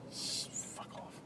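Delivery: whispered. Real, not acted. Muttered dismissal.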